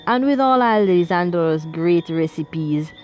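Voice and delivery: put-on lightly Italian-accented, fond and unhurried. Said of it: "In his Italian accent".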